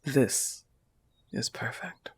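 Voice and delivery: breathy hushed whisper; soft whisper. Acted. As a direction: let it fall to a whisper. Tender morning confession.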